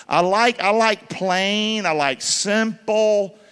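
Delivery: each word drawn out slowly; slow and emphatic. Acted. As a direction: lean on plain and simple, slowly.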